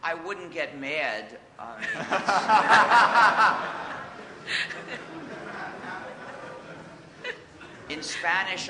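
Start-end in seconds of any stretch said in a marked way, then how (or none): 1.9-7.8 long loud high laugh. Real, not acted. Speaker amused by a questioner's odd pronunciations, laughing. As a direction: burst out laughing, then let it die away.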